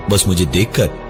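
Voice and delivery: usual speaking voice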